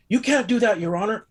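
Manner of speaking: high, indignant squeal; mocking prosecutor. Prosecutor protesting to the judge, in a high mock squeal. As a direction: mimic a flustered objection.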